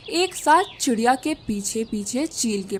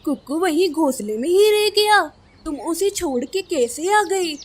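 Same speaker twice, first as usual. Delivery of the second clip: alarmed father, pitched higher; shocked disbelief; 7 semitones higher, 4 dB louder than usual. Acted. Crow father to his wife who left their son behind, shocked. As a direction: echo the bad news in raised, incredulous alarm.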